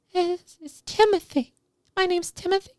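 put-on very high, hesitant child's voice; shy little boy. Shy boy pulled up to the piano, high and hesitant. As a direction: stammer it out like a shy kid.

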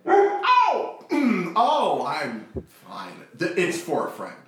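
startled yelp, then flustered cover-up. Acted. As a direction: caught off guard, blurt it out high and loud. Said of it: "my heart just kinda leapt up into my throat".

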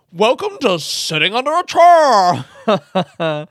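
slow, loud, laughing host voice; chirpy radio presenter. Pretend. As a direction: stretch it out like a cheesy DJ.

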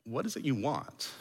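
very soft, after a long pause; deep, searching question. Shown as a demonstration. Jesus asking with seventeen layers of meaning, soft searching question. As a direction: ask quietly, looking into their soul. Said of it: "sort of look deeply in their soul".

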